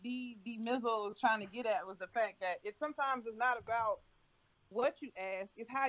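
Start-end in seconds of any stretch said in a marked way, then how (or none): none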